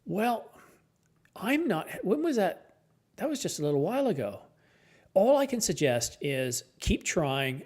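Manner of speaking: ordinary talk, nothing marked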